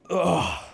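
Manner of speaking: sharp high grunt of effort; pained grunt. Acted. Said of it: "he's like".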